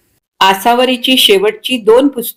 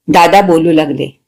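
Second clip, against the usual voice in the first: ordinary delivery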